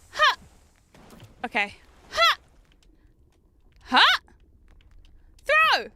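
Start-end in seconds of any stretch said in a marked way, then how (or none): 5.4-5.9 high, loud throw call; shouted command. Real, not acted. Streamer throwing in the game, high loud yell.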